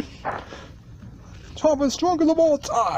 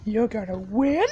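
ordinary talk, nothing marked

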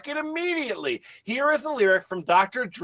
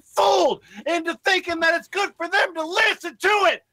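mock angry rant; 7 semitones higher than usual. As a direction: rail at him in mock outrage. Sarcastic rant at [name].

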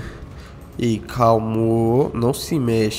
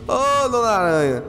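pleading high voice; 9 semitones higher than usual. Acted. Pleading with a spider NPC.

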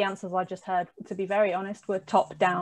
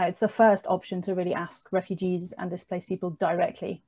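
ordinary talk

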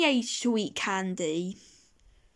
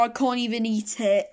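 ordinary talk

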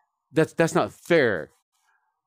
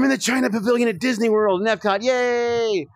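excited gasp; 9 semitones higher than usual. Pretend. Excited about Disney World.

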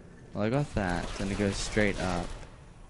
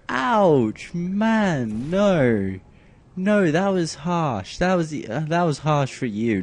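loud protest; 5 semitones higher, 7 dB louder, slower than usual. Real, not acted. Reacting to GLaDOS insult.

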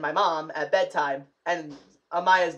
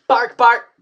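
loud, higher mock barking; robot dog bark; 5 semitones higher, 10 dB louder than usual. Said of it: "I'm Goddard from Jimmy Neutron".

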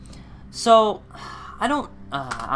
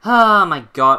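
loud, fed-up cry; loud groan of disbelief; exasperated outburst; 10 dB louder, slower than usual. Real, not acted. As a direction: blurt it out in real frustration. Venting about the lost recording.

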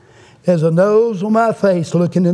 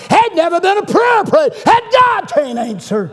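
high, full-force pulpit shout; preaching shout; 11 semitones higher, 3 dB louder than usual. Real, not acted. Preacher assuring the congregation about prayer, shouting. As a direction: cry it out as the climax. Sermon climax, third rising refrain.

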